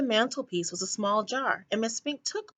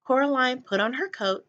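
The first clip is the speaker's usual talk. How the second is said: plain reading voice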